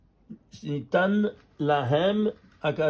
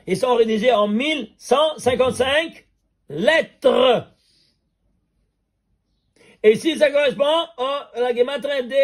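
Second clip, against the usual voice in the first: excited; 8 semitones higher, 5 dB louder than usual. Real, not acted. Reveals gematria match, then "incroyable.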